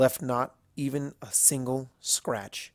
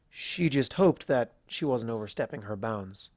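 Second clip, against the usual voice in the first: usual speaking voice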